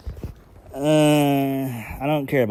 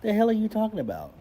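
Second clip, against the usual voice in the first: pitched way up, incredulous, the last question rushed; exasperated disbelief; 8 semitones higher than usual. Retold or imitated. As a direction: voice climbing high in disbelief at the father's claim. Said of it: "I'm like".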